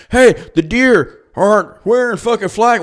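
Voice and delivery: slow, mock clueless voice; hammed-up clueless politician; mocking quote. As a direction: parrot him like a fool.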